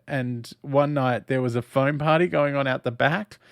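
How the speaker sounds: ordinary delivery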